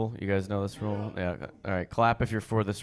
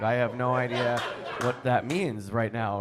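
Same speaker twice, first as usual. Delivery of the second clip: ordinary delivery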